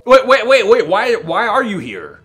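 loud, high indignant yell; outraged shout. Real, not acted. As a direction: shout it in disbelief. Host bursts in, outraged.

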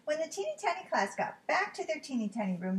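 ordinary delivery, nothing marked

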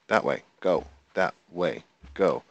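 ordinary talk

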